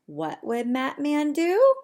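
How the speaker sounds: usual speaking voice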